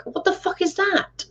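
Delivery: high, squeaky stunned question; retold disbelief. Retelling how she reacted to the boy's claim, high and stunned. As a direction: recoil in disbelief at the strange word. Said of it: "And I was like".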